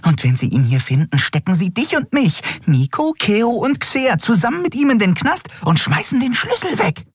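very high, frantic little voice; alarmed, high-pitched ranting; agitated Nelen. A narrator's reading. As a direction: argue in a high squeak.

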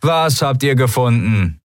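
mildly annoyed shout back; annoyed call. A narrator's reading. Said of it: "rief jemand genervt".

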